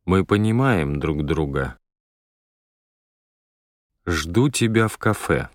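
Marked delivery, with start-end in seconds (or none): none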